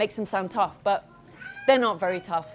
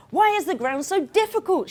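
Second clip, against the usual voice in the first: mild shout; 8 semitones higher than usual. Acted. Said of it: "shouts Prince".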